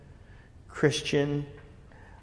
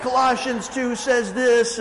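slow, higher, put-on preacher voice; preachy quoting; 7 semitones higher, 4 dB louder than usual. Evangelist citing scripture at the speaker, preachy imitation. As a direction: quote scripture like a know-it-all. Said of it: "and he's like".

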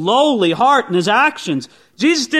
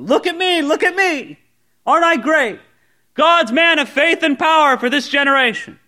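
higher-pitched showy boasting; mock a preacher crying out for attention; hammed-up self-promoting boast; self-promotion; 4 semitones higher than usual. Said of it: "this spirit of self-promotion".

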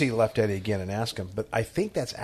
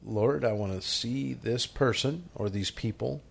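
quiet prayer; 6 dB quieter, slower than usual. Retold or imitated. Stopping to pray about hard-to-love people, quiet prayer. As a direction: pray it softly and unhurriedly.

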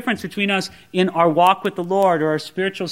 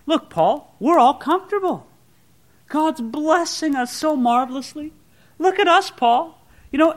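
smug Corinthians; 7 semitones higher than usual. Retold or imitated. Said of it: "the Corinthians look at him and they go".

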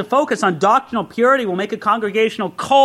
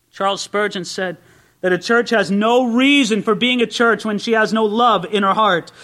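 ordinary delivery

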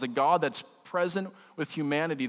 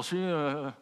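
softer, swallowed mumble of Sheila; mumbled; 4 dB quieter than usual. Shown as a demonstration. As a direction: mumble "Sheila" under your breath so it blurs. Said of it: "Or you can just mumble".

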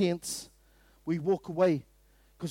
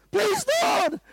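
high, hammed-up desperate prayer; pleading mock shout; 11 semitones higher than usual. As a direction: imitate someone yelling a plea at heaven. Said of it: "we're like that".

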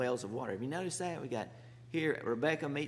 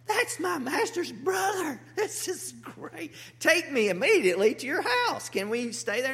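excited; 11 semitones higher, 5 dB louder than usual. Retold or imitated. Servant finds Rebekah is his master's kin, excited. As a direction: play the overjoyed servant. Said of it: "And this is really exciting".

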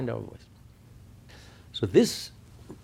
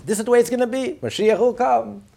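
higher, louder declaration; matter-of-fact certainty; 10 semitones higher, 6 dB louder than usual. Retold or imitated. Teacher quotes the Rebbe on what will be, with firm certainty. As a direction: state it as settled, raised and emphatic. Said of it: "with a simplicity. He doesn't say maybe".

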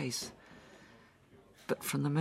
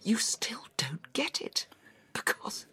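anguished whisper; 9 semitones higher than usual. Acted. Angry distressed confession.

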